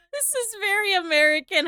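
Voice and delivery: high laughing remark; laughing hard. Real, not acted. Laughing hard at an American video with chat. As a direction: crack up mid-sentence.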